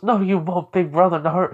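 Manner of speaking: put-on high-pitched kid's retort; cheeky kid voice. Boy contradicts his new big brother, cheeky high voice.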